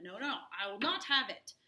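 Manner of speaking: mild imitated outburst; mock anger. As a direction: play the angry husband, hammed-up. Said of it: "You get mad, Proctor".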